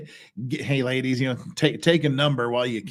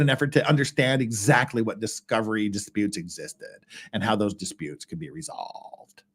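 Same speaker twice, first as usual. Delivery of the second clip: creaky fried voice with a put-on lisp; mock valley girl fry, lisping every S; sustained vocal fry and faked lisp while reading a legal brief. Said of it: "me doing that vocal fry voice".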